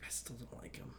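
barely audible stubborn whisper. Real, not acted. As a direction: breathe it under your breath. Repeats stubborn line, whispered.

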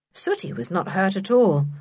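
ordinary delivery, nothing marked

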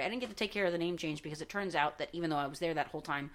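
usual speaking voice

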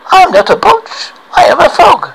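high little frog voice; small frog voice. Acted. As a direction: squeak it out like a tiny frog. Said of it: "said Average Frog".

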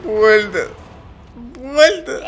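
laughing through pain. Real, not acted. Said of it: "я горло надорвал от смеха". (смех сквозь боль)

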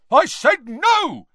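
high, slow, loud furious shout. A narrator's reading. Brutal-faced man blocks Greyback, shouting. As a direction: shout it slowly, each word forced out. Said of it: "shouted the brutal-faced man".

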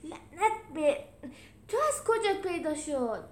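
loud, high startled cursing; startled outburst. Acted. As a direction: jump out of your skin and demand an answer. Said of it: "چنان از جا پرید".

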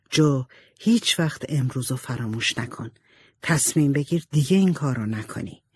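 low, tender mother's voice; loving motherly counsel. Acted. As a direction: gently advise and reassure your daughter. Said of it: "با محبت بوسید و گفت". (نصیحتِ مهربان مادرانه با صدای بم)

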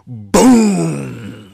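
high, loud shouted boom; impact boom. Retold or imitated. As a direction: blast out the crash. Kaido slamming his club.

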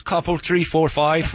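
imitating an Irish trainer's count. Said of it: "five is Irish couple".

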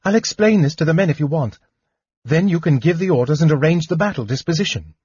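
high, fast, excited chatter; bubbling, hurried enthusiasm; eager. A narrator's reading. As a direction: rush it out eagerly, like a child with a new toy. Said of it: "eager to show a parent a new toy".

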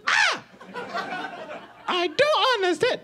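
high, slow, outraged African auntie; aunt's African accent. Retold or imitated. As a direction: squeal then drag out the disbelief. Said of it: "She was like".

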